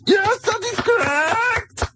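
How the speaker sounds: very high, slow hammed-up German accent; movie villain german accent. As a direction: shriek it as a comic German villain. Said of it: "Nazi accent very well".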